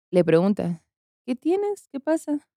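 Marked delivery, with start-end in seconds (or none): 1.3-2.5 high, soft worried asking; hushed high voice checking on a sibling; gentle concern. Retold or imitated. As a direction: ask softly, as to a crying little sister. Sister asks crying girl.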